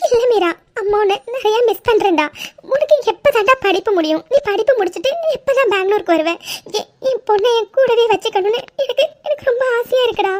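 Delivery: tearful, high-pitched pleading mother; crying. Acted. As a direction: voice climbing as the tears take over. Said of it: "please அழாதீங்க".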